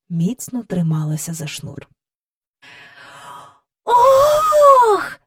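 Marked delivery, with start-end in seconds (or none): 3.8-5.2 високий, гучний радісний вигук; радісно скрикни, впізнавши. A narrator's reading. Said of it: "радісно вигукнула Джейн". (Джейн радісно скрикує)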